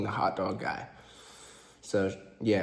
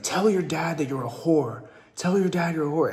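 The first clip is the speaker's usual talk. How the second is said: higher, mocking cruel command; cruel boyfriend; 6 semitones higher than usual. Boyfriend forcing her to insult herself to her dad, higher mock sneer. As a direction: sneer it out as the abuser.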